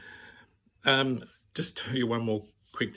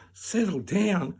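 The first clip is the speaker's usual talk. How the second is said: slow, higher echo in disbelief; incredulous echo; 5 semitones higher, slower than usual. Retold or imitated. Patient throwing doctor's words back, incredulous.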